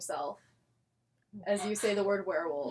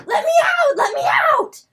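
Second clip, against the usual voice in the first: monster shout; 16 dB louder than usual. Acted. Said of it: "He goes".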